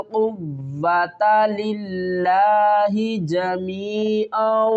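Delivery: slow, measured tajweed recitation; Quran recitation. Shown as a demonstration. Teacher reciting the verse slowly for students. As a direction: chant each word with care for the students.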